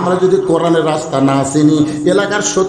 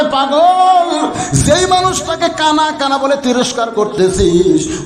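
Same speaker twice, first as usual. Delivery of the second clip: tearful cry; 3 semitones higher, slower than usual. Acted. Companion telling the shepherd who Osman is, tearful chanted cry. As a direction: wail the reproach, stretching out the last words. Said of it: "সাহাবী কান্দার বলে".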